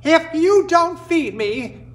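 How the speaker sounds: high, loud, slow furious yell of a small bird; angry yell. Acted. Orphan wren yells at the people in fury. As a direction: scream the threat through angry tears. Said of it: "he yelled".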